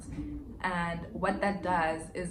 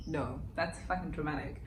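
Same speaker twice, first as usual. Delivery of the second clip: ordinary talk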